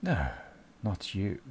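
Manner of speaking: low, slow, scornful menace; sinister voice. Acted. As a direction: sneer at the fools from the dark. GM voices the dark presence.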